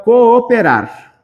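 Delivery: COOPERAR slowly, holding both O's; stretched double O. Shown as a demonstration. Teacher showing a joined prefix to students, stretched vowels. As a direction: draw out the "oo" in "cooperar".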